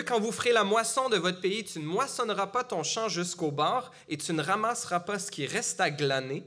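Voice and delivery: ordinary talk, nothing marked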